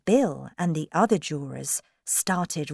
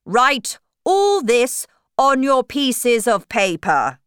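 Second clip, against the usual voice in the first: loud, raised commanding voice; storybook king; 6 semitones higher, 8 dB louder than usual. Acted. King ordering the jurors to write, loud commanding voice. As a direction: give a loud royal order. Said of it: "the king told the jurors".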